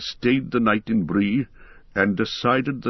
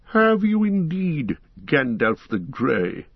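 slow mocking wizard, opening much higher; scoffing; 5 semitones higher, slower than usual. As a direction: scoff at him slowly, disbelieving. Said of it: "he scoffed".

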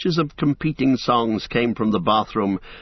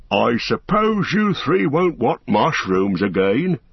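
slow, resigned wistful ask; ask, expecting a no; without much hope; 3 semitones higher, slower than usual. A narrator's reading. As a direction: Fredegar offering mushrooms, slow hopeless ask. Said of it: "without much hope".

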